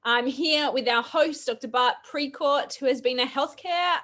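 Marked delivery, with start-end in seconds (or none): none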